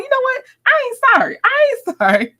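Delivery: hammed-up imitation of her saying it; playful high impression; mimicking Queen. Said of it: "She's like".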